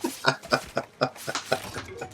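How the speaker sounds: soft, voiceless staccato chuckle; real laugh. Real, not acted. Laughing at his own "I'm a hooch" line, amused. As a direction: laugh quietly in a run of breathy bursts.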